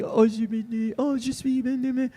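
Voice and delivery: lilting imitation French, kept gentle; imagined French speech. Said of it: "you think it's gonna be".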